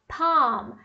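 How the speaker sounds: "palm" said PAHM; silent L. Shown as a demonstration. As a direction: say "palm" with the L left out. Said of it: "A gente não tem um L aí". ("palm" sem L, PAAM)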